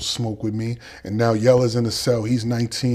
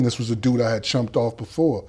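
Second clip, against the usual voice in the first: ordinary talk, nothing marked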